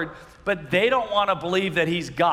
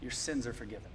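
tender Jesus; 5 semitones lower, 5 dB quieter than usual. Retold or imitated. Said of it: "looks at the paralyzed man and says".

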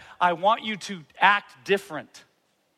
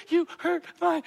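put-on high, whiny teary child voice; mock hurt child; 10 semitones higher, much slower than usual. Daughter telling parent he hurt her feelings, mock teary. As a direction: play the wounded little girl with a laugh. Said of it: "daughter crying, hurt feelings".

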